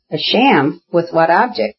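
ordinary delivery

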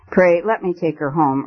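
usual speaking voice

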